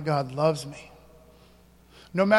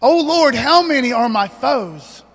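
loud, higher cry to the Lord; loud, higher psalm cry; loud psalm reading; 5 semitones higher, 9 dB louder, slower than usual. Acted. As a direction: cry the verse out.

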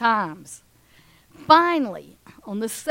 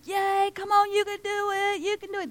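high, bright mock cheer from the stands; root for the kid, higher pitched; cheering crowd; 9 semitones higher than usual. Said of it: "everybody on this side is rooting".

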